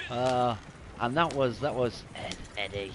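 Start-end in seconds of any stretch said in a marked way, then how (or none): 2.2-2.9 put-on low, quiet, mischievous old English ghost; creepy poltergeist voice. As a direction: croon the name like a teasing spirit from the corner. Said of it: "You could tell in the voice".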